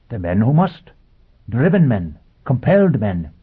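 high, earnest robot voice; a machine preaching with conviction. Acted. Robot answering Foyle.

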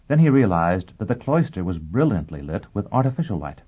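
usual speaking voice

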